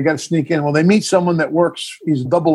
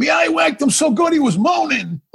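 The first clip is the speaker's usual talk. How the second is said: much higher, cracking up through the words; laughing punchline; 12 semitones higher than usual. Retold or imitated. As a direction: giggle out the boast, pitched way up. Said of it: "He goes".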